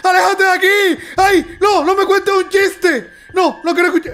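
high-pitched playful panic; mock alarm. Gamer shooing the monster Jester away, alarmed. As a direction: cry out as if a monster just appeared.

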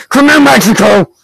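sudden heated gamer outburst; raging. Real, not acted. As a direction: shout after a rival player in a rage. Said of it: "So rage right now".

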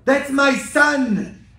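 slow, loud, high grieving cry; anguished cry. Retold or imitated. Mother at her son's execution, slow anguished cry. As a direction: draw it out in anguish. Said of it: "she said".

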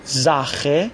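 زاخه opening on a buzzed z. Shown as a demonstration. Teacher shows s before a vowel, زاخه starting with z. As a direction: start زاخه with z, then a throaty "kh". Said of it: "پس اس باید ز تلفظ بشه".

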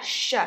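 "sh" made with the tongue curled up. Shown as a demonstration. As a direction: model the "sh" sound clearly. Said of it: "các bạn cong lưỡi lên".

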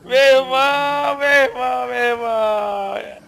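loud, drawn-out disbelief; panicking gamer. Real, not acted. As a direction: stretch it out in loud shock. Disbelief after death.

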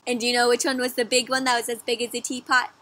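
ordinary delivery, nothing marked

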